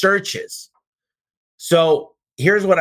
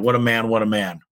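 normal talking voice

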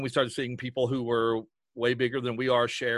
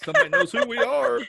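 high, loud, giddy mock thrill; excited squeal; 11 semitones higher, 5 dB louder than usual. Co-host joins in re-enacting their first shout-out, excited squeal.

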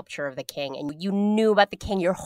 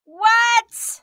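real squeal of disbelief, much higher; shocked what; 5 dB louder than usual. Real, not acted. Cohost hears of handling bloody heads, shrieks in shock. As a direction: burst out in genuine shock.

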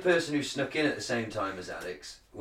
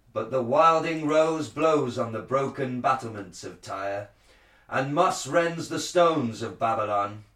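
Shakespearean actor; 2 semitones higher than usual. Shown as a demonstration. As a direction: proclaim it like a druid priest. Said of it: "like a Shakespearean, um, uh, actor".

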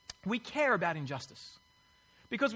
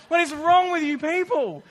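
higher and louder mock exasperation; incredulous raised-voice complaint; exasperated outburst; 8 semitones higher, 9 dB louder than usual. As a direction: vent at the useless reps. Retold fury at customer service.

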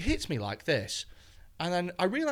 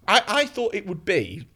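normal talking voice

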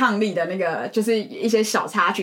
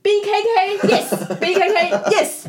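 slow, high, laughing sing-song of B K K; laughing naive repeat; 8 semitones higher, much slower than usual. Retold or imitated. Retelling to a friend, laughing as her clueless self. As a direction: giggle through the same clueless answer.

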